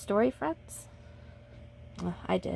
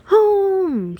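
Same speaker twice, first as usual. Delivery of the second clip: high, loud single-word shout; sharp loud call; 11 semitones higher, 8 dB louder than usual. Acted. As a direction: yell it to the runner. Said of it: "shouted the boys".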